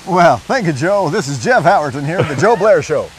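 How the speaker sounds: a hint of a booming TV host; lightly done radio host announcer; Joe Blair TV voice. Shown as a demonstration. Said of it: "has to do his Joe Blair for you".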